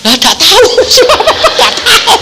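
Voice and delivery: high laughing shout; loud high laughter while quoting the wife. Retold or imitated. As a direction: laugh through the words. Laughing retelling wife's reply.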